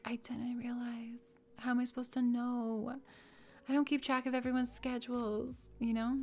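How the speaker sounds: hushed, sheepish reply, starting near a whisper; acted meek excuse. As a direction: play a small, wounded self-defense. Acts caller's meek reply to scolding.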